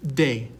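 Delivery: de said DAY, falling; Spanish letter name. Shown as a demonstration. Teacher naming letter D in Spanish, clear demonstration. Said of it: "pronounced as it's written up there".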